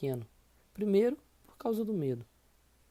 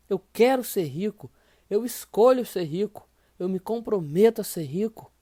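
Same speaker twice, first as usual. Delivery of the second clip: louder, emphatic vows; loud affirmation; 3 semitones higher, 8 dB louder than usual. Shown as a demonstration. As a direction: belt out the vows again with force. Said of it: "Fala de novo".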